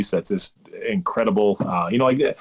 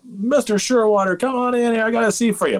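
effusive host impression; 14 semitones higher than usual. Restaurant host showing a favored guest to his seat, high effusive mock welcome.

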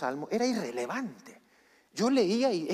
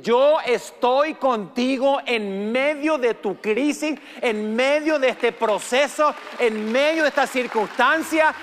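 slow, louder, higher proclaiming God's words; 5 semitones higher, 8 dB louder, slower than usual. Acted. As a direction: announce God's promise with power. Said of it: "el Señor te dice". (voz de Dios proclamando)

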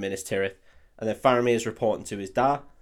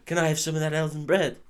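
lightly voiced hungry hobbit, pitched up; Frodo; 5 semitones higher than usual. Hungry hobbit asking for elven bread, lightly mocked pleading. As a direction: plead a little, in mock hunger. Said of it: "Frodo's like".

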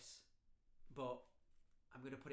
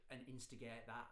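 ordinary talk

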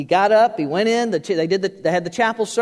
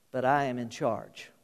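quiet declaration; 6 semitones lower, 9 dB quieter than usual. Acted.

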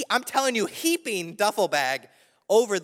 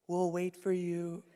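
imitated mouthing; 9 dB quieter, slower than usual. Friend mouthing through the closed train door, exaggerated soft slow delivery. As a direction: voice it faintly, as if through glass. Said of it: "mouthing to me".

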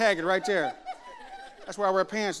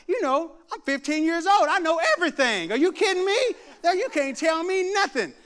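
put-on high, loud know-it-all fifteen-year-old; cocky teenager; 7 semitones higher, 5 dB louder than usual. Preacher mimicking rebellious teens to the youth, cocky. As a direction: mouth off like a cocky teen. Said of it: "you try to act like".

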